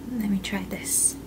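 soft whisper before tasting. Real, not acted. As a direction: whisper it to yourself. About to taste the food.

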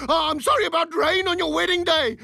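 high, melodramatic guilt; overwrought remorse. Acted. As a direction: pour out mock remorse. Coach spirals into overwrought apology.

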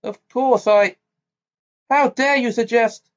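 high, loud, offended outburst; heated. A narrator's reading. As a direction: bristle with wounded pride. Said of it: "her voice was heated".

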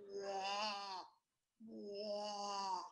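long, droning snore-like frog croak; imitated frog snore. Shown as a demonstration. Naturalist showing children how the crawfish frog snores, imitated snore. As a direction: drone a drawn-out "waah" like a snoring frog. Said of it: "he snores, a little bit different snore".

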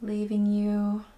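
slow, calm meditation voice with long pauses; guided meditation. Acted. Teacher guiding beginners into breathing and stillness, slow guided meditation. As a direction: ease them in slowly, softening as phrases end.

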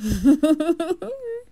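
high, drawn-out tittering; rising string of giggles; amused giggle. Acted. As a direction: titter away at the young master. Amused exit after teasing.

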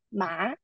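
ma rising softly upward; second tone ma. Shown as a demonstration. Teacher repeating the rising tone for students. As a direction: let ma climb as a quiet question. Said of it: "It's a rising tone".

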